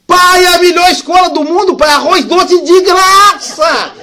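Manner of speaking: put-on high, loud, thrilled shout of a delighted boy; a kid bursting with joy over free rice pudding; overjoyed child. Son telling his father about school, thrilled and shouting. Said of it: "E fez uma festa".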